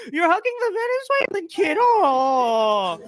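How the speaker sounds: high, whimpering fake tearful gush; mock weepy liberal. Vlogger mocks residents hugging a child, high fake weepy gush. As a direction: sob it out in a syrupy falsetto.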